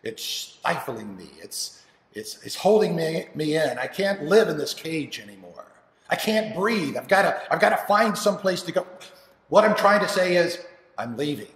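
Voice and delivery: desperate to get out, voice rising; frustrated son. Acted. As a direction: build from strained complaint to loud declaration. Son's outburst at father.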